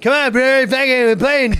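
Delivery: loud, high badgering; rally them noisily, acting out the castmate; imitated pushy castmate. Competitive castmate pushing hallway football, mock insistent yelling. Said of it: "he like".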